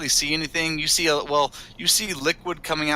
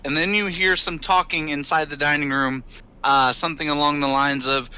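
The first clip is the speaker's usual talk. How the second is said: normal talking voice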